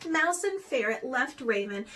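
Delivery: plain reading voice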